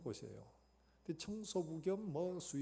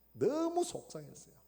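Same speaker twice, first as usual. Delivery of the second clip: exasperated; 11 semitones higher, 8 dB louder than usual. A narrator's reading. Preacher dramatizes Bruce's frustration.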